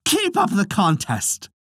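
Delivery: high, mocking taunt. A narrator's reading. Ket goading the silent Ulster warriors, high taunting. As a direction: goad them on, pitched high and sneering. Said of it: "cried Ket tauntingly".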